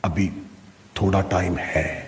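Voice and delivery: lightly acted low, soft inner excuse; quietly voiced inner temptation to linger. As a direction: play a sleepy self-excuse, low and soft. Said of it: "دوسری آواز آئی".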